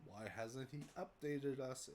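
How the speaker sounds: normal talking voice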